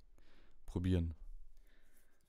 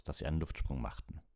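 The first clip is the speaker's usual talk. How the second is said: ordinary delivery, nothing marked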